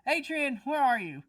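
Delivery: loud call from a distance. Acted. Father calling for Adrian from afar, loud. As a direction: call out to a missing kid. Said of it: "another voice kind of yell".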